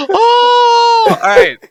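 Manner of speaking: high startled cry. Real, not acted. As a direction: yelp it out high. Startled after a gasp.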